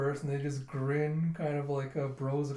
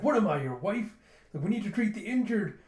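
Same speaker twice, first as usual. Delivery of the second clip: higher, louder fussy retort; mock indignation; 6 semitones higher, 4 dB louder than usual. Boy objecting to pirate's orders, mock indignant. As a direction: act out an exasperated objection.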